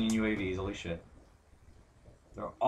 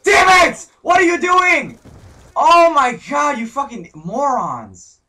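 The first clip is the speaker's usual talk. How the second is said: much higher, loud, slow furious yell; enraged shout at the team; screaming at teammates; 17 dB louder, slower than usual. Real, not acted.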